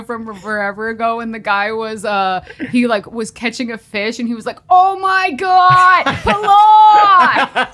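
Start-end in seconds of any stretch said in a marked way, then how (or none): 4.7-7.7 high, slow, euphoric yelling; ecstatic shouting. Retold or imitated. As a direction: scream with overwhelming joy. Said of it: "the guy, that viral video".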